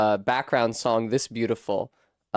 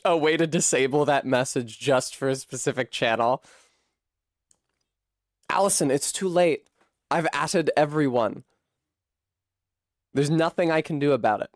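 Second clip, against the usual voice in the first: normal talking voice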